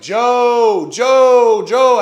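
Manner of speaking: imitating a loud, high shout for someone; calling out loudly. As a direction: holler the name repeatedly, as retold. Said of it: "I had my shit, like, cranked up".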